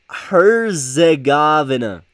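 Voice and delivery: Herzegovina drawn out slowly and loudly; slow, emphatic struggle through the name; labored pronunciation. Real, not acted. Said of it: "I've always had difficult".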